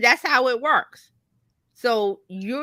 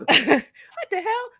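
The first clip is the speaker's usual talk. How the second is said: laughing echo; 8 semitones higher, 4 dB louder, much slower than usual. Retold or imitated. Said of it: "laughing echo".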